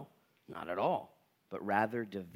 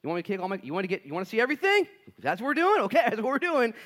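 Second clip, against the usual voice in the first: high, loud, rushed bravado; mock-brave patient at the doctor's; imitating an eager patient; 9 semitones higher, 7 dB louder, faster than usual. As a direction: play the patient daring the doctor. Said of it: "You're like".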